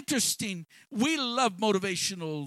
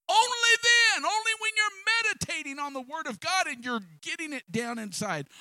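higher and louder, ringing on only then; preaching emphasis; 12 semitones higher, 3 dB louder than usual. Real, not acted. Preacher stressing to the congregation only then, ringing. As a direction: hammer home the condition.

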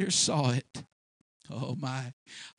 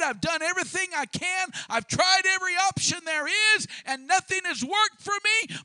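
rising to a higher, louder cry of frustration; frustrated outcry; 11 semitones higher, 5 dB louder than usual. Acted. Simon protesting he has tried everything, frustrated outcry. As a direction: let his exhaustion burst out.

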